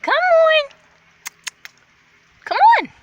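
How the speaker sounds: high, loud sweet coaxing; coaxing call. Real, not acted. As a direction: coax the dog in. Coaxing the dog.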